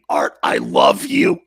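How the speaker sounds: loud, drawn-out love yell; big fond shout; affectionate shout. Real, not acted. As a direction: holler it at him with joy. Host yelling affectionately at caller.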